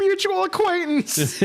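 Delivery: very high mock cry; hammed-up high-pitched wail; mock scream. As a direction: shriek it like the delayed scream.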